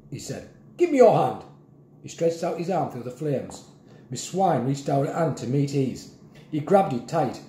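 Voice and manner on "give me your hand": high, loud rescuer's command; urgent command. A narrator's reading. Grandpa reaching to Miss Swine through flames, urgent command. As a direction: urge it over the noise.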